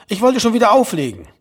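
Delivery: mother repeating her complaint; nagging mother. A narrator's reading. Mother repeats herself on phone.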